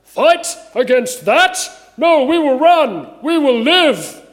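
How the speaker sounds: loud, high, drawn-out heckle from the ranks; yelled out. Acted. Soldier shouting back at his leader's call to fight, yelling. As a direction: yell back in defiant refusal. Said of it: "probably where someone should yell out".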